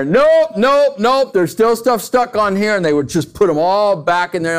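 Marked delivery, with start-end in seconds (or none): 0.0-2.7 high, loud mock "nope, nope" of a strict mom; mock stern mother at the sink; mom inspecting dishes. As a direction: imitate mom rejecting the dishes, pitched up. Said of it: "the inspection would come".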